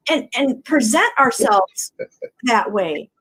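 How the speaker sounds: ordinary talk, nothing marked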